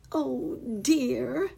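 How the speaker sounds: high, drawn-out "oh dear"; plaintive exclamation. A narrator's reading. Hidden voice in the bushes, plaintive exclamation. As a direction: wail it lightly, high in pitch. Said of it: "exclaimed the voice again".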